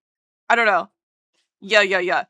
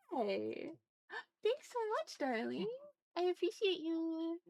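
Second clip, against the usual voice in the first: much softer, breathy-high gratitude; hushed thanks; 6 semitones higher, 19 dB quieter than usual. Acted. Streamer thanking a darling viewer in a soft, high hush. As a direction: whisper your thanks up high.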